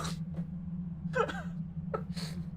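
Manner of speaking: high breathless cackle; real wheezy laughter; wheezing laugh. Real, not acted. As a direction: laugh until it squeaks. Laughing at own JoJo bit.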